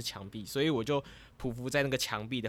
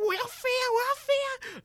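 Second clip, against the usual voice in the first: very high, a bit louder, straining to take off; desperate high cry; 5 dB louder than usual. Retold or imitated. Ghost dreamer trying to fly to the crash, in a very high desperate squeal. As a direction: squeal it like a kid willing herself to fly. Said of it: "我就说".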